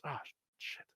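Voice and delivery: very soft, whispery swearing; resigned muttered curse. Acted. Man remembering the trouble he is in, soft whispered curse. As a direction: let the curse out under your breath.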